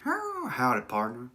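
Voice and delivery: mock cowboy with a country twang; country accent. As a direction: greet like a hammed-up country cowboy. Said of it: "saying it in a country accent".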